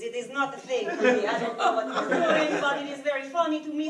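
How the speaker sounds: normal talking voice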